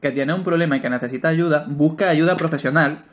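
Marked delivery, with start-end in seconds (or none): none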